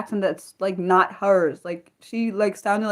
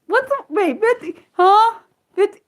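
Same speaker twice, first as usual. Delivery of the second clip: baffled; 11 semitones higher, 5 dB louder than usual. Real, not acted. Confused by chat's fifty-one.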